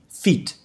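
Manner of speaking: "feet" with a tense ee; long-i pronunciation. Shown as a demonstration. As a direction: say "feet" with Portuguese i. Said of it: "Letra E".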